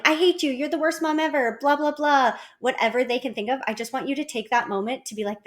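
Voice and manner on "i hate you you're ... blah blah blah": imitated child yelling. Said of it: "if they're yelling".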